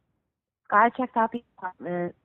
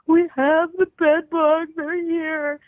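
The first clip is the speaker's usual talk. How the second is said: high, louder, slowed wail of panic, imitating herself crying; retold tearful panic; 9 semitones higher, 4 dB louder, slower than usual. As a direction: act out sobbing the bad news, half-mock. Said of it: "I'm like".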